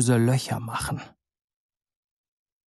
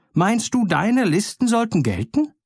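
angry birds; 8 semitones higher than usual. A narrator's reading. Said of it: "riefen die Vögel noch zorniger".

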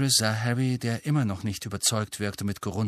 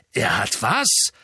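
slow, louder disbelieving cry; called out; 4 dB louder, slower than usual. A narrator's reading. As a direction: call it out in shock. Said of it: "rief Sirius".

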